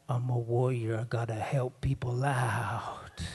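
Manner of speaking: low, slow solemn warrior thought; mock-heroic low resolve; nephew's inner voice. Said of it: "he's like".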